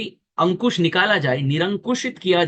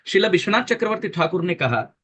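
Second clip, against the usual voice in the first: ordinary talk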